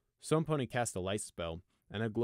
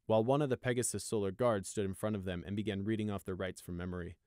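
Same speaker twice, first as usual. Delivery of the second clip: plain reading voice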